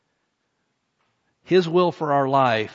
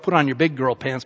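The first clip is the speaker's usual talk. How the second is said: normal talking voice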